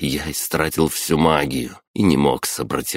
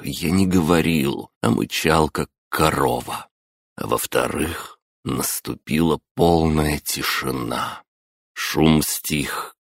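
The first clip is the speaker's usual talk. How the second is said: ordinary talk, nothing marked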